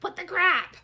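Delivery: mock outrage. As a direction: imitate his indignant outburst. Mocking the man's outburst.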